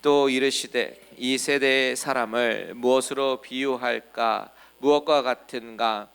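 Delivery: slow, loud unison Bible reading; reading scripture aloud together. Shown as a demonstration. Pastor leads the congregation reading the verse aloud, slow and loud. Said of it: "같이 한번 읽어보겠습니다".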